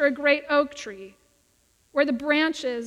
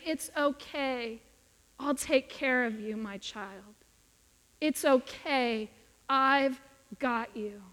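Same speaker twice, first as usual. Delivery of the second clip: tender reassurance; 2 semitones lower, 4 dB quieter, slower than usual. Retold or imitated. God speaking to each of us, slow soft tender comfort. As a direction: hush it like cradling a frightened child. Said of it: "Wanting to pick up each one of us and say".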